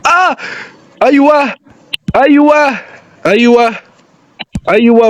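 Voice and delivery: high-pitched excited yells; high, punchy cries of encouragement; excited cheering. Real, not acted. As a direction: urge the attack on in short high bursts. Cheering on his attack in the game.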